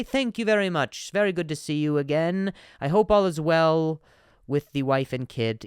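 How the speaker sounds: lightly hammed-up stiff politeness; mock formal politeness. Replying to Randall with mock-polite formality. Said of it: "That's what I would say".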